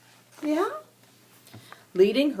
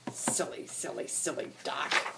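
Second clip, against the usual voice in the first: softer, breathy teasing; whispered taunt; 4 semitones lower, 9 dB quieter than usual. Acted. Ducklings whisper their jeer at the duckling, hushed.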